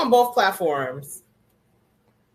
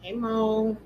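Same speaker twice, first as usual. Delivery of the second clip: ordinary delivery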